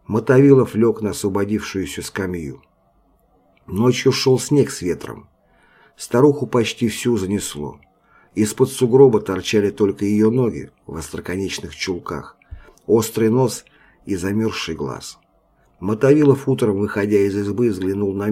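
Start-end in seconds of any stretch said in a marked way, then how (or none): none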